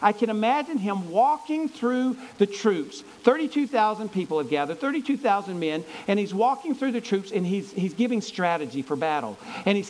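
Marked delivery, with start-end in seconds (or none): none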